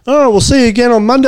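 high, bright, chirpy goodbye; cheery boss voice. Retold or imitated. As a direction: imitate an upbeat manager. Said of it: "I got so pissed off and was like".

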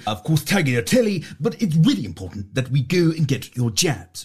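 rambling mock politician speech; politician impression. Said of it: "Let's have a few".

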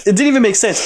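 ordinary talk, nothing marked

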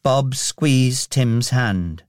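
usual speaking voice

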